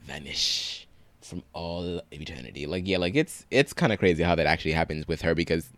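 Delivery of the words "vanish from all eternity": slow, hushed mock-dramatic echo; mock ominous. Podcast co-host playfully echoing that the girlfriend will vanish, mock ominous. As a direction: draw it out softly like a pretend spell of doom.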